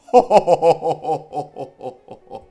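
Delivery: loud, round "jo jo jo" laugh. Shown as a demonstration. Preacher demonstrating a laugh on the vowel O, loud. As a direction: boom a laugh on O. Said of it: "se ríen con la letra O".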